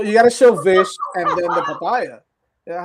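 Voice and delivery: usual speaking voice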